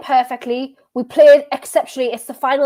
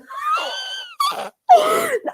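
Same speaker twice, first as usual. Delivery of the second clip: very high squealing laugh; real laughing fit, high and squeaky; helpless laughing. Real, not acted. Football fan cracking up at players fighting, helpless high laughter. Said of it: "I'm laughing, but".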